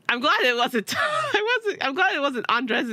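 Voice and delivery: high, laughing through the words; words broken by laughter; laughing relief. Real, not acted. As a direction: giggle out the relief.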